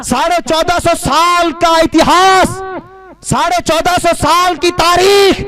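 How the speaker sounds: high, loud, sustained declamation; full-force preaching. Acted. Preacher exhorting young men about beards, full-force preaching. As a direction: proclaim each phrase to a big crowd.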